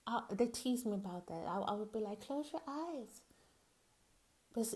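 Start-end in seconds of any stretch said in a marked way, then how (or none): 2.2-3.2 put-on high, soft, drawn-out child's plea; a hint of a little girl, higher and slower; lightly voiced little child. Little girl telling people to close their eyes, lightly childlike. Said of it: "since I was a little bitty child".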